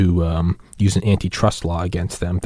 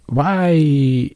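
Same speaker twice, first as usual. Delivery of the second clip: drawn-out, higher-pitched why; acted bafflement; 7 semitones higher than usual. Puzzled onlooker questions the odd hilltop building, baffled. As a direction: stretch it out in mock disbelief.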